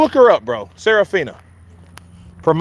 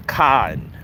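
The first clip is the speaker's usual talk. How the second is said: Boston accent; 2 semitones lower, 5 dB quieter, slower than usual. Shown as a demonstration. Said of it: "you start kinda adapting to".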